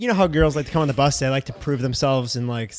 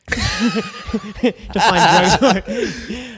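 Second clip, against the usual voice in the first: laughing; 8 semitones higher, 6 dB louder, much slower than usual. Real, not acted. Laughing while telling story.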